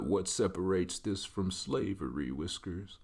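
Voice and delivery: slow, soft, solemn dog voice; measured and hushed, a pet gravely accusing its owner; talking dog. Acted. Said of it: "That's how my dog talks".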